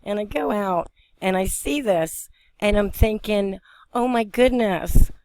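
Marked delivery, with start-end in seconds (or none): 3.9-5.1 high, slow recalled exclamation; mildly re-enacted astonishment; retold amazement. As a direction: relive the amazement, drawing it out. Retells her thought on seeing it.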